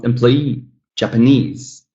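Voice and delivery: "employee, Japanese" stressed on EE and NESE; third-syllable stress. Shown as a demonstration. Teacher showing students third-syllable stress in "employee, Japanese". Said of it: "third syllable stressed".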